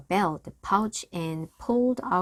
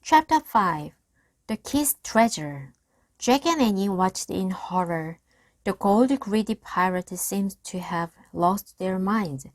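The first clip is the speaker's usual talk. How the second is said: plain reading voice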